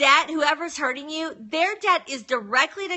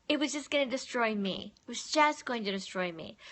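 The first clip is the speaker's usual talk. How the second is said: much softer, sober confession; hushed admission; 8 dB quieter than usual. Real, not acted. Confiding to listeners what anger cost her, hushed admission. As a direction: drop the volume right down and confide it.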